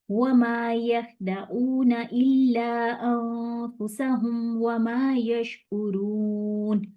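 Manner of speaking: slow, chanted tajweed reading of the verse; slow Quranic recitation. Shown as a demonstration. Teacher recites Surah Baqarah verse nine for students, slow chant. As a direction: recite the verse slowly and melodically. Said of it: "इसको हम कैसे पढ़ेंगे".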